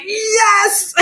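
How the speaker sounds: high, gleeful little cheer; excited yes. Retold or imitated. Wife cheering that her husband stays away, gleeful. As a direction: celebrate getting the bed to yourself. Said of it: "I was like".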